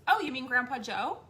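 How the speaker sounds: put-on high, loud, bright kid question; breezy child. Son asking if mom means Grandpa, breezy. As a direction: answer like an unbothered child. Child answers casually, retold.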